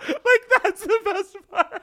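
high, slow, breaking up with laughter; laughing hard. Real, not acted. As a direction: squeeze the punchline out through real laughter. Cracking up at a made-up rumor.

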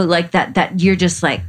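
ordinary delivery

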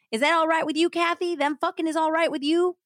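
loud, high hillbilly outrage; hammed-up Southern jealous husband; Southern accent. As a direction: demand an answer in a Southern twang. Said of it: "read this in a Southern accent".

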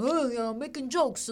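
lightly put-on Italian American patter; mock Italian American. Doctor chatting up her mom, mock Italian American. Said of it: "he talks like this".

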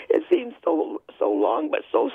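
high, halting, upset voice; real emotion. Real, not acted. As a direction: struggle through it, upset and high-pitched. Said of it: "I get emotional".

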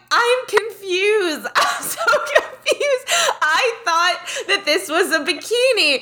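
high, drawn-out wail through laughter; re-enacted high, laughing protest; screaming and laughing. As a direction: imitate being too helpless with laughter to talk normally. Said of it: "I'm screaming and laughing so hard".

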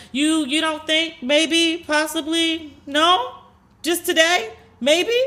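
very high, slow, leading questions; gently prod, each word a high tentative question; imitated coaxing friend. Friend coaxes her with leading questions.

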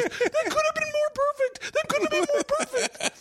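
high, far-off laughing voice, still cracking up; laughing brother. Retold or imitated. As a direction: quote him while laughing hard. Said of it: "your brother laughed for a solid five minutes".

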